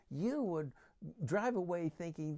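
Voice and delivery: ordinary talk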